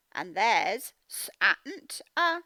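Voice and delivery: santa broken into s-a-n-t-a, slowly; sounded out slowly. Shown as a demonstration. Teacher sounding out santa for pupils. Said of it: "sounding out santa".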